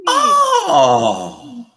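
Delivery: loud, high "oh!" of surprise; astonished realisation. Real, not acted. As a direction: cry out as the answer lands. Reacts to the answer being revealed.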